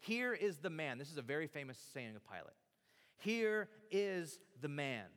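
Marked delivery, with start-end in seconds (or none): 3.2-5.1 drawn out and weighty, word by word; slow proclamation. Shown as a demonstration. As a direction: stretch out HERE and IS, proclaiming it. Repeats famous saying for weight.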